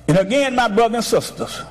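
"Nobody" को ऊँचा खींचकर प्रचार; preaching. Acted. Preaching at full force.

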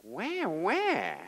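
high, drawn-out crybaby wail; wah-wah like a mocking crybaby; mock whining. Preacher mocking Gideon's self-pity, whiny wail. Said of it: "What a whining objection".

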